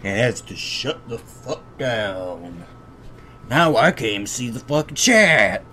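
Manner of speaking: ordinary talk, nothing marked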